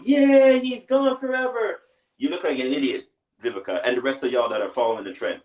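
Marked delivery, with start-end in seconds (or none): 0.0-1.8 high, loud, slow gloating cheer; mock celebration. Mocking women cheering Kevin Samuels' death, high slow gloating cheer. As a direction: ham up someone gleefully dancing over a death.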